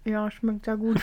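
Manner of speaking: talking with food in mouth; mouth full. Real, not acted. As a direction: speak through a mouthful. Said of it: "Mit vollem Mund spricht man nicht".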